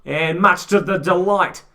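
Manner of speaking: lively sports commentator call; play-by-play commentary. Acted. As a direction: call the rugby action live. Live rugby play-by-play.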